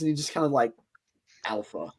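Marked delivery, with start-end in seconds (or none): 1.4-1.9 a low, soft single word; lowered last word. Acted. Captured ranger answers the poacher, low and quiet. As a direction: say it low and quiet, almost under the breath.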